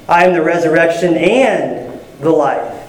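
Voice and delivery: "and" pushed up and set apart; stress on AND. Shown as a demonstration. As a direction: lift "and", then pause before the end. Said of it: "you just emphasize a different word".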